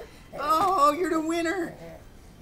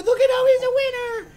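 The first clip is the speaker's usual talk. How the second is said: excited cheer; 3 semitones higher, 8 dB louder, faster than usual. Real, not acted. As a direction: cheer him on like a sports fan. Cheering baby arm wrestle.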